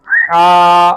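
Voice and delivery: loud, drawn-out recited letter; Quranic recitation. Shown as a demonstration. Teacher demonstrating correct letter recitation, recited. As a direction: intone it like a reciter. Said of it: "बाद लोग इसको हा पढ़ते हैं".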